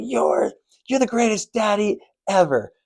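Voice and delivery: put-on high, raspy five-year-old girl voice; raspy little girl. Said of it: "she has this raspy voice".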